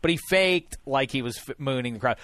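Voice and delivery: normal talking voice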